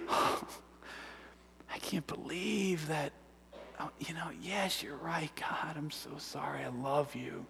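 very soft, overwhelmed gratitude; hushed, tender apology; moved whisper. Retold or imitated. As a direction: breathe a grateful prayer, almost voiceless. Acts out comforted response to God.

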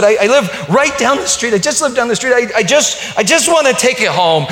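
high, loud, urgent begging; eager pleading. Retold or imitated. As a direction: beg to take the cake home, voice rising. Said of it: "she said".

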